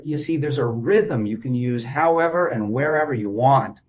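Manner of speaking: usual speaking voice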